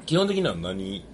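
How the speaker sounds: ordinary delivery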